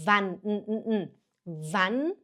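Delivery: "Wann", then the bare n hummed alone; N-sound demo. Shown as a demonstration. Teacher shows learners the N sound, separated "Wann, n, n". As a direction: isolate the final n of "Wann". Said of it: "o som do N".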